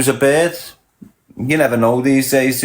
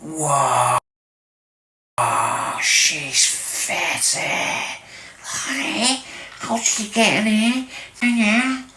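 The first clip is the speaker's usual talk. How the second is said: put-on hushed then high, awed young lad; kid's awed voice; 7 semitones higher, 4 dB quieter, much slower than usual. Kids in Anfield gawping at an older girl, hushed then high. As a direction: gawp like a little kid seeing an older girl. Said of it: "and we'd be like".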